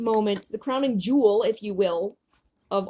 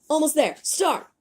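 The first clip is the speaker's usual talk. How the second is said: raised, brief go order; called-out command; 8 semitones higher than usual. Acted. Leader directs falling teammates, calling out. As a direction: call out the restart.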